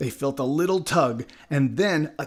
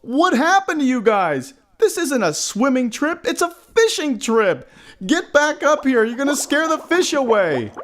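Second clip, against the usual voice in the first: high, exasperated shout; scolding, high-pitched shout; 12 semitones higher, 4 dB louder than usual. A narrator's reading. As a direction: holler down at friends overboard. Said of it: "Mike shouted down below".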